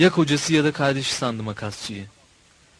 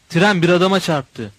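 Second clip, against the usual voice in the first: loud, higher alarmed cry; shout; 4 semitones higher, 7 dB louder than usual. A narrator's reading. A passenger shouting that the train hit a man, loud alarmed shout. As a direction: yell the alarm to the whole carriage. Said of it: "diye bağırdı".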